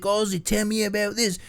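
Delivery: high, put-on Cockney voice; playful high Cockney interviewer; Cockney accent. Mimicking the British guitarist in a high Cockney voice. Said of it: "he has that Cockney accent".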